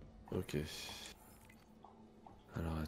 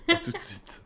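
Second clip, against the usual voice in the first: playful falsetto farewell; squeaky high goodbye; 3 dB louder than usual. Pretend. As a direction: pop it up into a silly high voice. Goodbye before a bathroom break. (petite voix aiguë et taquine)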